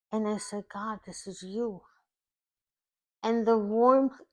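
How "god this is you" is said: quiet awe. Retold or imitated. Awed words to God.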